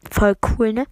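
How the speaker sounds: ordinary talk, nothing marked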